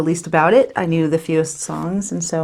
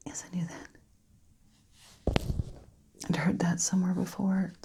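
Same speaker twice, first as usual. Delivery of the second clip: very soft, halting recollection with long pauses; near-whispered dramatic monologue; grieving Lucy, hushed; 12 dB quieter, faster than usual. Acted. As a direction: barely voice it, reliving the wait for the ambulance. Monologue's quiet ending as Lucy.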